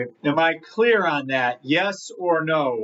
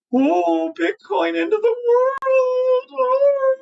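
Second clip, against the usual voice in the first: much higher and louder, a slow hammed-up wail; mock panic; 4 dB louder, slower than usual. Trader mocking the Bitcoin doomsayers, a hammed-up high wail. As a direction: squeal like a doomsayer in fake terror.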